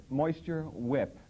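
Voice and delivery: Moisture Whip drawn out very slowly; slow mocking repeat. Comic repeating the lipstick name, slow and mocking. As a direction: linger on each word as if tasting it.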